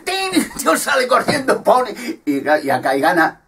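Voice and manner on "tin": a very high sung ding like a bell; short high bell ding, softer; imitated bell ding. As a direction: ring it out high like the fight bell.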